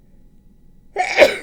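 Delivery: a loud real sneeze; sudden explosive sneeze; sneezing. Real, not acted. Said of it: "I'm gonna sneeze".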